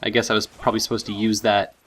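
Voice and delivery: normal talking voice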